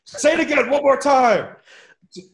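shouting orders from off set; director yelling. Retold or imitated. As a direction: yell it across a noisy set. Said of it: "Pete Berg is off set going".

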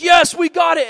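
high, loud victory cheer; mock triumphant shout. Crowd that has rescued a goldfish, triumphant mock shout. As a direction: imitate a crowd cheering success.

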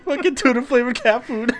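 high-pitched punchline between laughing fits. Real, not acted. Storyteller savouring the punchline with co-host, laughing. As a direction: repeat the punchline, helpless with laughter.

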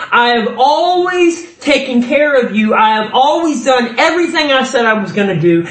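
high, insistent preacher voicing God; aggrieved, ringing declamation as God; God's impassioned appeal. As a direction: plead God's case to a wayward people. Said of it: "So what God is saying in verse three is".

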